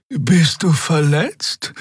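slow, high worried question. Acted. Xea asks wounded Keru, slow and worried. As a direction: ask gently, full of concern. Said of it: "fragte Xea besorgt".

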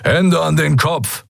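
a hint of a gruff pirate command; demanding pirate. A narrator's reading. Pirate orders the newcomers to raise their hands, stern demand. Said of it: "forderte ein dunkelhäutiger Mensch".

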